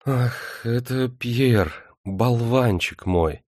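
slow, shy embarrassed confession. A narrator's reading. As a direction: admit it bashfully, slowly. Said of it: "Смущённо она призналась".

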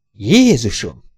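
pained groan on the word. A narrator's reading. Hardy gags on the vermouth and groans, pained groan. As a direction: force it out like a retch after the drink. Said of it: "nyögte".

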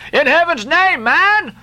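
high, exasperated shout; exasperated cry. A narrator's reading. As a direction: cry out at his folly. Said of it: "cried Stubb".

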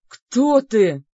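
loud rasping demon gasp; wheezing gasp. Acted. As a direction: gasp it out, winded. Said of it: "задыхаясь, прохрипел Дангар".

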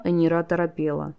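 ordinary delivery, nothing marked

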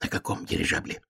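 voiceless soft whisper; hushed aside. Acted. Hare bends to the frog to check, whispering. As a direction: whisper it down to someone small. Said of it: "склонился к лягушонку заяц".

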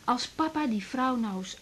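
zacht, traag gefluisterd; fluisterend. Acted. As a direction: fluister het voorzichtig. Dochter fluistert tussen ruziënde ouders. (dochter fluistert zacht tegen moeder)

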